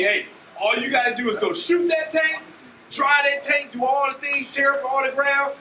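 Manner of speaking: high, rolling sales patter; eager recruiter's pitch. Retold or imitated. Acts out recruiter selling the job.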